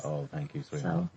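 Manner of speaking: quiet, touched thank you; soft and touched. Real, not acted. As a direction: thank her softly, genuinely touched. Soft reply to compliment.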